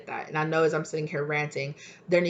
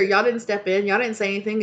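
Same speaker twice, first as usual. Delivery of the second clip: ordinary talk, nothing marked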